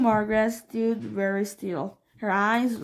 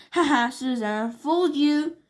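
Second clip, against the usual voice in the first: high gloating shriek; higher, louder mocking yell; 5 semitones higher, 5 dB louder than usual. A narrator's reading. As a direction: crow over Susan, high and loud. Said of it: "shrieked Harry".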